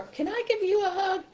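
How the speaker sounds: high, sympathetic puppet voice; high-pitched animal character; Softy. Shown as a demonstration. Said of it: "This is Softy".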